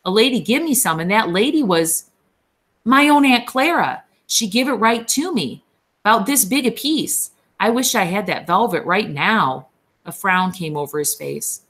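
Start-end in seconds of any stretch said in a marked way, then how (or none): 0.0-9.7 a lightly voiced simple-minded big man, excited; childlike eager Lennie, mildly higher. A narrator's reading. Lennie tells Curly's wife about velvet, lightly childlike.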